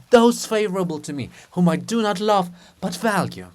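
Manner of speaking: loud, soaring declaration; impassioned. Acted. Andrew refusing the enemy, loud impassioned declaration. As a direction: proclaim it to the heavens.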